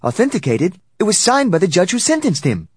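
high, loud indignation; incredulous retort. A narrator's reading. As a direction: throw the word back, then protest loudly.

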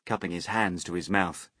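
ordinary delivery, nothing marked

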